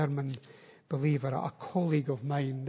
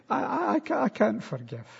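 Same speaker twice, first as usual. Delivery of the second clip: high, stammering objection; halting protest; 6 semitones higher than usual. Acted. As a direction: stumble over the start as an acted objector. Voices an objector struggling to forgive.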